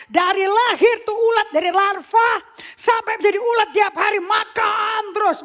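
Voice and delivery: high and loud, shouting it out; preaching shout. Acted. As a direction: hammer it home at full volume.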